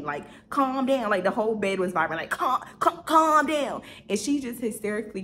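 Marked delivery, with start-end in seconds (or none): 0.5-1.1 louder, higher mock shout; imitated yelling. Chill man suddenly on ten, imitated yelling. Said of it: "this man was on ten". 2.3-3.8 high, loud, stuttered mock shout; imitated yelling at ten. Man whose shouting vibrates the bed, imitated yelling. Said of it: "this man was on ten".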